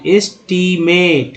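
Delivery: एस्टिमेट slowly as इस-टी-मेट; syllable by syllable. Shown as a demonstration. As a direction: pull एस्टिमेट apart into three long syllables. Same word split into syllables.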